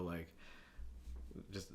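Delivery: ordinary talk, nothing marked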